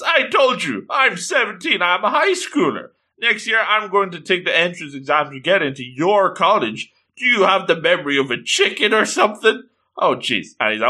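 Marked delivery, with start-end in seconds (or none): 0.0-9.6 put-on high shouted girl's rage, then easing; furious outburst. Girl yells at Kuro who thinks she's in middle school, furious. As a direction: shout her age at him, offended. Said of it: "this makes Kotoko furious".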